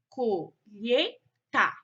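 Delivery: "colheita" slowly split co-lhei-ta; syllable by syllable. Shown as a demonstration. Teacher showing pupils syllable division, "colheita" said in separated syllables. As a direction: break "colheita" into three separate syllables, pausing between. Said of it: "foram separadas em sílabas".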